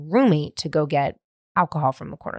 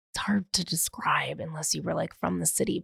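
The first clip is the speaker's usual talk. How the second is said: whispered aside; 10 dB quieter than usual. Real, not acted. Aside while describing the bar.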